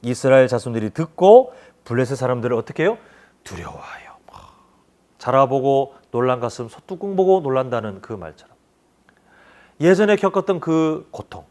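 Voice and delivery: usual speaking voice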